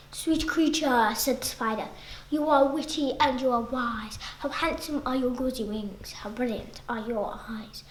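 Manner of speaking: flattering spider. Acted. Spider whispering flattery to the fly. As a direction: purr the compliments softly.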